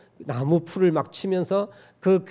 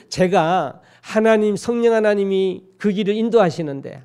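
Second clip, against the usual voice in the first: ordinary talk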